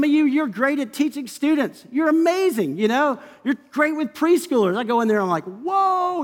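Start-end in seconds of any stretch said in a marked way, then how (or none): 5.4-6.2 high, drawn-out whoa of mock alarm. Preacher walking in among preschoolers, mock alarm. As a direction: play being overwhelmed, pitch jumping up.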